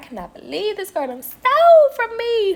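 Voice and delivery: high, outraged disbelief; can't believe it, voice way up; shocked indignation. Retold or imitated. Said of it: "I was just like".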